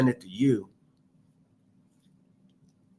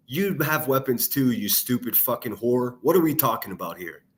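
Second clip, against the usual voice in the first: normal talking voice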